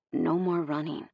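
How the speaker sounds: low, softened firmness; quiet, gentle insistence; soft voice. A narrator's reading. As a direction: say it softly but firmly. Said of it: "his voice soft".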